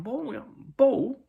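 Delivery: high, loud exasperated fan; mock indignant terrace shouting; imitated yelling. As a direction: holler it as if it's obvious. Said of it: "yelling out".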